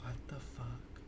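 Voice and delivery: unvoiced, soft frightened whisper; scared whispered swear. Real, not acted. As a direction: whisper so the monster can't hear. Hiding, scared.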